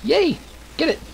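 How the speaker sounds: high little cheer; excited cheer. Real, not acted. Streamer cheers on favorite character, excited cheer. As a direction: cheer them on, pitched right up.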